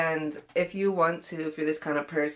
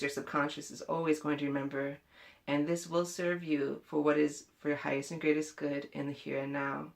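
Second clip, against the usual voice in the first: ordinary delivery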